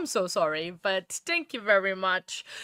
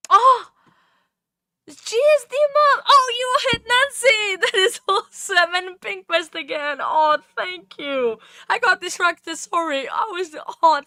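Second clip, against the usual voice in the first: high, loud, thrilled yelling; excited shouting; 8 semitones higher, 8 dB louder than usual. Real, not acted. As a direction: burst out cheering at the hit. Celebrating a gift hit live.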